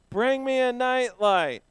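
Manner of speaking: slow, loud, high shout; group yell. Shown as a demonstration. Leading the children on a count of three: slow, loud, high yell. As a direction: draw the yell out with the kids. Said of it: "Ready? One, two, three".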